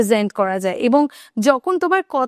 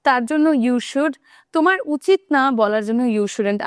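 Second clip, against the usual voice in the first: normal talking voice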